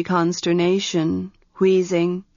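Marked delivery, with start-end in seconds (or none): none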